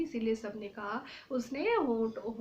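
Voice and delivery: ordinary talk, nothing marked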